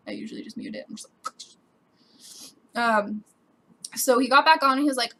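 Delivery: ordinary talk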